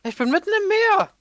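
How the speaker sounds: high-pitched cry of surprise; startled exclamation. Real, not acted. Player comes out of portal into sea, high startled cry. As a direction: blurt it out high in disbelief.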